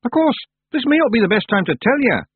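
put-on miserable Scottish accent. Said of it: "Rob went on miserably".

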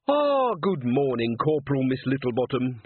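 hearty greeting with a drawn-out opening; cheerful. Acted. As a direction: greet her brightly and formally. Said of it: "said Carrot cheerfully".

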